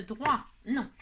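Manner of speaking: ordinary talk, nothing marked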